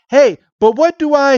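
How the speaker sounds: high, panicked protest; flustered, high. Retold or imitated. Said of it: "Ash is like".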